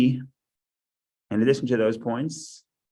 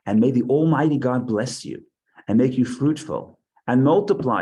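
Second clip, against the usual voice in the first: higher, louder solemn reading voice; scripture reading; 3 semitones higher, 3 dB louder than usual. Acted. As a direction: proclaim the verses to the class.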